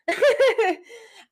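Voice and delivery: loud high laugh, breaking up; big burst of laughter. Real, not acted. Host cracking up after calling herself a Texan, loud laugh. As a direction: let a real laugh burst out.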